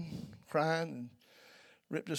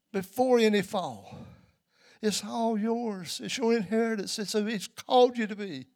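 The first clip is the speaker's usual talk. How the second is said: higher, louder preaching build; fervent sermon peak, higher and louder; 6 semitones higher, 8 dB louder than usual. Acted. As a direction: lift the congregation, full force. Preaching climax.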